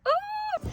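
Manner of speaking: sudden high squeal of excitement; excited squeal. Real, not acted. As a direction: let out a quick thrilled squeak. Arriving, excited outburst.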